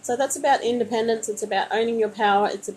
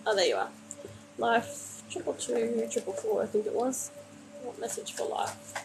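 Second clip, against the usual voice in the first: murmured to self; 8 dB quieter than usual. Real, not acted. Card reader asking for a viewer's message, murmured. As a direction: mutter the question under your breath. Asking the cards under her breath.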